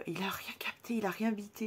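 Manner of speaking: unvoiced conspiratorial whisper. Real, not acted. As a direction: whisper it like a secret. Aside about brother.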